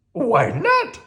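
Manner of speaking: high, loud curious monster; Grover voice. Acted. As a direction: Grover asking why.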